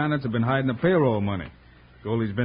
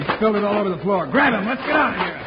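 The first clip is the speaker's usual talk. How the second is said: urgent shout; 7 semitones higher, 4 dB louder than usual. Acted. Escaping the exploding powder keg.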